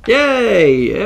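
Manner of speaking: loud high cheer; delighted shout; excited yay. Real, not acted. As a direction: cheer the lucky roll. Cube roll success.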